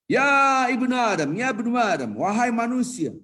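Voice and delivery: high, loud, drawn-out summons; God calling mankind. Acted. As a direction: call out solemnly to all humanity. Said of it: "Allah berkata kepada hamba-hambanya".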